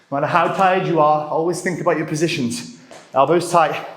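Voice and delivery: ordinary talk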